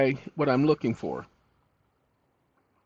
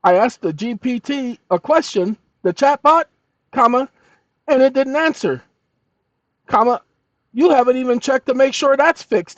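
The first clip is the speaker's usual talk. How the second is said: louder, higher indignant dictation; angry dictation; 9 semitones higher, 6 dB louder than usual. Real, not acted. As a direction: fire off the complaint.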